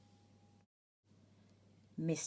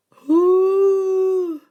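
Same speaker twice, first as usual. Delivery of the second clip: high, loud, eerie call; spooky who; 11 semitones higher, 15 dB louder than usual. Acted. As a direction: hoot it like a ghostly owl. Dramatic question in verse.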